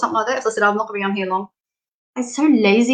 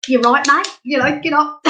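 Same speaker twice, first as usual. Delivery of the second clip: mock matey gee-up, higher and louder; blokey tough-love mate; 6 semitones higher, 4 dB louder than usual. Laughing at herself, doing a blokey mate telling a friend to get up. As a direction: friend giving a gruff push to get up. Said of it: "I'm a bit masculine".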